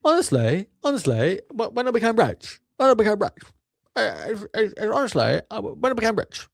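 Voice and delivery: squeaky high mocking voice, hesitant; mock apologetic rich guy. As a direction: parody a timid millionaire in falsetto. Mocking the podcaster's apologetic words.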